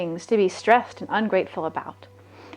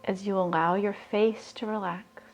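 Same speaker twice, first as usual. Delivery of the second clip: guided meditation; 9 dB quieter than usual. Acted. [name] guiding listeners to raise their vibration, soft meditation voice. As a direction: breathe the words out calmly, leaving long pauses.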